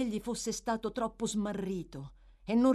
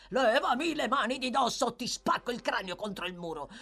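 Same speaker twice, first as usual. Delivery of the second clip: raised, violent threat; furious higher-pitched outburst; angry threat; 4 semitones higher than usual. A narrator's reading. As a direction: threaten while fighting someone off. Sikes threatens while struggling.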